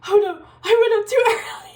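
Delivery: high, loud, hurried imitation of dismay; mock panic. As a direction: blurt it out like a flustered friend, half laughing. Imitating friend's panicked realisation.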